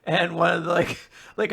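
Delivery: usual speaking voice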